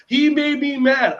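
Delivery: put-on high, loud, slow child's complaint; whiny child complaint. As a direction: play the upset little kid telling on a friend. Child complaining, quoted by teacher.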